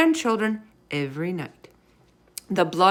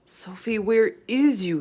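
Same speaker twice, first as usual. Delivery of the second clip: desperate inner thought; 4 semitones higher than usual. A narrator's reading. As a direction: think it in panic. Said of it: "he thought desperately".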